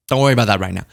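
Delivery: ordinary talk, nothing marked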